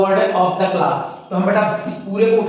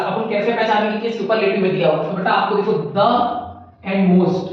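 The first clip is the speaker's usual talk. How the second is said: ordinary delivery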